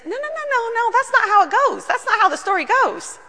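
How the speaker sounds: high, insistent no-no-no of disbelief; Peter protesting. Acted. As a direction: cry out in high, flustered protest. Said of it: "Peter says".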